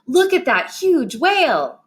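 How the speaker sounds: high, excited outburst with big swoops on "huge whale"; excited exclamation. Shown as a demonstration. Teacher showing an exclamation point, high excited exclamation. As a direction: exclaim it with strong feeling. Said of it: "want to show a strong feeling".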